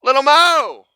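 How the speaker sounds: loud, high drawn-out call. Pretend. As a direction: holler the name like a summons. Calls out to Little Mo.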